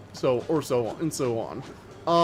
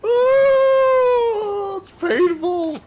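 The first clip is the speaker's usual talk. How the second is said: very high, loud drawn-out comic wail; pained wail; 7 dB louder, much slower than usual. Real, not acted. Streamer groans over Dracula's unreadable Texan accent, pained wail. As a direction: wail loudly, way up high, as if in pain. Anguished reaction to badly written accent.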